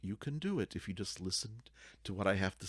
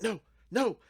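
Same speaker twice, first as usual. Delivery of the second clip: panicked cry; 14 semitones higher, 6 dB louder than usual. Acted. Panicked teen at dead end.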